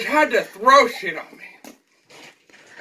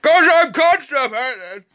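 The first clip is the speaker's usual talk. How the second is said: dramatic announcement; 6 semitones higher, 3 dB louder, slower than usual. Pretend. Dramatic reveal to sibling.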